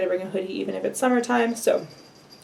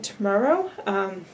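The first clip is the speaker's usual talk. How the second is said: ordinary talk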